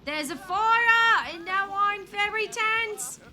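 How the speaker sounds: loud, high, drawn-out mock British panic; British accent. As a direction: cry out in a hammed-up British accent, building to a shout. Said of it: "British accent".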